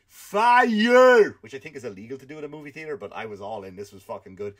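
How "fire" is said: high, loud yell of fire; imitated shout. Comedian mimicking a moviegoer yelling in a cinema, loud shout. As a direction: bellow it like the guy in the front row. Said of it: "He just kept going".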